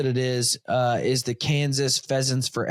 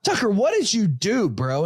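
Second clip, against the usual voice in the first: dad startled by the burning trash can, calling to his son; alarmed dad; 7 semitones higher than usual. Retold or imitated. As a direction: catch the kid mid-mischief, alarmed. Finds the kitchen trash on fire.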